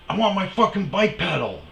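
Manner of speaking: high, loud imitated snarl; mock angry yell. Stranger snapping at him, imitated as a high loud yell. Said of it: "he goes".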